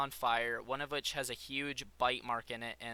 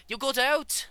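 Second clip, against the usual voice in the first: high, loud Irish cry of alarm; Irish-accented shout; 13 semitones higher, 9 dB louder than usual. Acted. Scientist spotting his escaped creature, alarmed Irish yell. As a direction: exclaim it shrilly in an Irish accent. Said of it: "didn't want him to be Irish".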